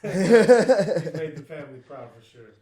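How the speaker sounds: loud laugh through the words; laughing proudly while speaking. Real, not acted. Laughing tribute to nephew.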